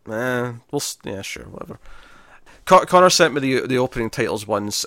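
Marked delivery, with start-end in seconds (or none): 0.1-1.8 quiet unvoiced dismissive mutter. Real, not acted. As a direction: brush it off under your breath.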